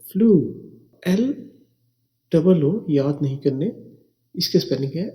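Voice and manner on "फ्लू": फ्लू blended in one quick syllable; read quickly. Shown as a demonstration. Teacher telling students to read it quickly, blended. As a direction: run फ्लू together fast. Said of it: "जल्दी से पढ़ना है आपने".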